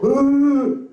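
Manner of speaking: high excited mock cheer; cheering gasp. Comedian mimicking the fans cheering at the name. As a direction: whoop like a delighted fan. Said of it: "other people go".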